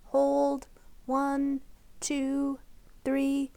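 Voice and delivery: gentle counted breathing guide; soft counting for a child's breath; breathing exercise count. Acted. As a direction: count the inhale softly and evenly.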